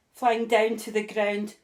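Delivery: ordinary delivery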